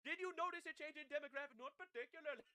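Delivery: hammed-up Indian accent parody. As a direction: replay the interview in an Indian accent. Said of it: "I just give Indian accents".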